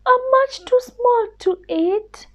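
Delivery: a tiny scared mouse begging pardon; little mouse voice. Acted. Mouse pleads.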